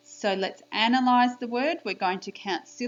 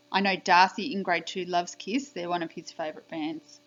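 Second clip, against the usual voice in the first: ordinary delivery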